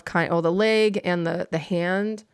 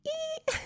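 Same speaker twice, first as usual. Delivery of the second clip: very high delighted squeal; tiny high squeak of delight; 1 cute squeal; 9 dB quieter than usual. Real, not acted. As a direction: squeal at the cuteness. Squeal over cute plush.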